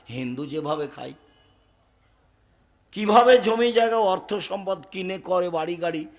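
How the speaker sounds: ordinary delivery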